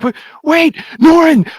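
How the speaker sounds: put-on high, loud kid's call after a runaway friend; calling out after him. Kids calling to their fleeing friend, high urgent yell.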